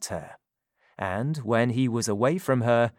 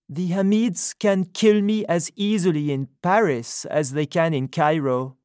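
higher, louder woman's voice, indignant; Sophie's voice; 6 semitones higher, 4 dB louder than usual. A narrator's reading. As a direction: speak up as her, indignant. Sophie answering Jonathan.

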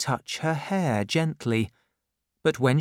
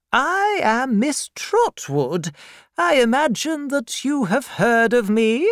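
a severe aunt demanding at the door; stern old aunt; 12 semitones higher, 5 dB louder than usual. Acted. Miss Betsy voiced.

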